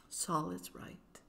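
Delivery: very soft, slow murmured agreement; hushed, slow and intimate; tender whisper. A narrator's reading. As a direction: agree tenderly, almost under the breath. Tender reply to Mama's gift.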